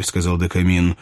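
ordinary delivery, nothing marked

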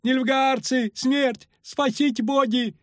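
high panicked wailing; terrified squeal. A narrator's reading. Ferryman begs gods to save him, terrified squeal. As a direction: wail for help at the top of your pitch. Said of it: "взвизгнул паромщик, бледнее".

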